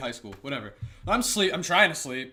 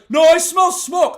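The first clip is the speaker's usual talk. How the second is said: much higher, louder, slow insistence; insistent brother; 12 semitones higher, 13 dB louder, slower than usual. Retold or imitated. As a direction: press the warning hard. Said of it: "And he's like".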